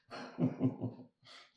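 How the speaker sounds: loud, high burst of laughter; hearty laugh. Acted. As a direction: laugh out loud at a mate's folly. Laughs at the devil tattoo.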